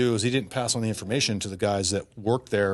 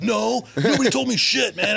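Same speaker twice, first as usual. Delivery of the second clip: higher, louder exasperated shout; freaked-out yell; 11 semitones higher, 4 dB louder than usual. Retold or imitated. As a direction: shout it in frustration. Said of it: "I was, like, freaking out".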